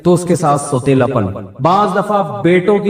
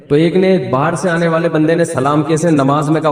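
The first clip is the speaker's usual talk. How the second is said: usual speaking voice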